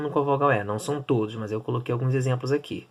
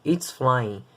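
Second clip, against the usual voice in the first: usual speaking voice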